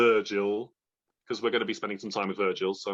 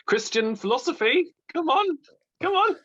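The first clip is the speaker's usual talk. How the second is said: much higher and louder, egging herself on; mock hype; 5 dB louder than usual. Reader hyping herself up about Augustine, loud mock cheer. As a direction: cheer yourself on like a rally. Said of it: "self-mocking hype about reading".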